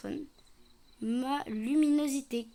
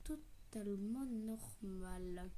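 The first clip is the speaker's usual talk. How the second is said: slow suspicious whisper; 3 semitones lower, 13 dB quieter, slower than usual. Real, not acted. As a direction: whisper slowly, eyeing her. Suspicious check of customer.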